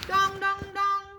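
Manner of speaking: high, loud bell clangs. Acted. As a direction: ring it out loud. Captain Crow rang his bell.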